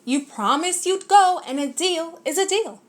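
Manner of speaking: lightly voiced pushy bug lecturing Horton; perky insistent bug scolding; bossy bug voice. A narrator's reading. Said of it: "said the Carabug".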